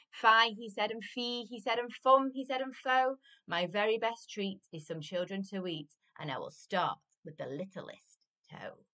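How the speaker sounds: lively, emphatic rhyming storytime voice; story reading to children. A narrator's reading. Adult reading a giant story to children, animated storytelling. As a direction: read it out with bouncing, punched words. Reads picture book to children.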